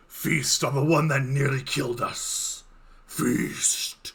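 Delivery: slow, sinister voice in the head; deep voice. Acted. Game master voices what V hears in their head, slow deep voice. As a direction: draw it out slowly, like an inner demon. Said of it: "you hear the deep voice again".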